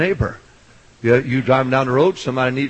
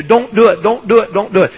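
raised, high repeated warning; imitated preacher's scream; 6 semitones higher, 6 dB louder than usual. Preacher imitating his own pulpit warnings, mock scream. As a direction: holler it in quick mock warning. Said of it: "it's like screaming at you".

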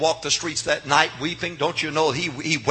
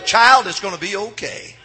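usual speaking voice

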